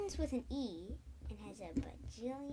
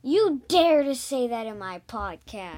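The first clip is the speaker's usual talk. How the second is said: loud indignant shout; mock outrage; 15 dB louder than usual. Real, not acted. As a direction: yell it in playful outrage. Kid mock-outraged at dad.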